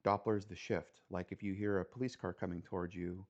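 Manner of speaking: ordinary talk, nothing marked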